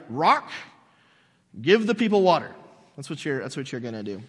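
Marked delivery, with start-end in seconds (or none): 0.1-2.5 commanding the rock. Retold or imitated. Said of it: "you're gonna be like".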